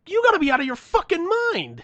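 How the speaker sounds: loud, very high-pitched retold outrage; outraged outburst. Driver told tow costs ninety dollars, outraged. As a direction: explode upward in disbelief.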